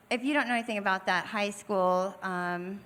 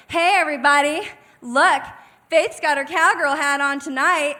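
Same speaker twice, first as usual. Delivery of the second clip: mocking call-out; 6 semitones higher, 10 dB louder than usual. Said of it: "She goes".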